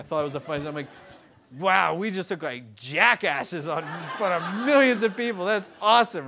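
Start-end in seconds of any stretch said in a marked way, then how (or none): 1.6-6.3 laughing it off. Retold or imitated. Laughing on camera as the boat falls apart. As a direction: chuckle through it, delighted by the mess. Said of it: "I'm sitting there laughing the whole time".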